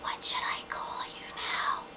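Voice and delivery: slow, hushed whisper asked politely. Retold or imitated. Girl discreetly asking my new name, whispering. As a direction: whisper it, like pulling a friend aside. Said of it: "um, whisper and be like".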